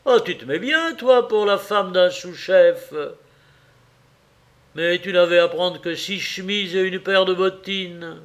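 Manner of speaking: high, loud, teasing protest; astonished reproach. A narrator's reading. Husband reacting to wife's shopping bill, astonished. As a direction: exclaim at the extravagance.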